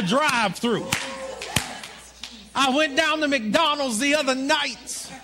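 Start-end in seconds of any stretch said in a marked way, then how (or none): none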